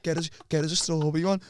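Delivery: hushed conspiratorial whisper. Retold or imitated. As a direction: keep it low and sneaky. Whispered plan in class.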